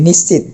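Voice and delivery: নিশ্চিত ending on a bare t, "nishchit"; common mispronunciation. Shown as a demonstration. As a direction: drop the final vowel of নিশ্চিত. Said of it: "এটা ভুল উচ্চারণ করে থাকে". (নিশ্চিত শেষে ও ছাড়া, প্রচলিত ভুল উচ্চারণে)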